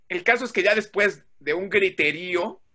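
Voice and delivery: ordinary talk, nothing marked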